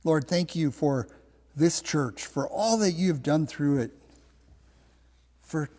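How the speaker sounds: measured, restrained prayer voice; closing prayer. Real, not acted. Pastor thanks God for the church, in prayer. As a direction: pray sincerely, pausing between phrases.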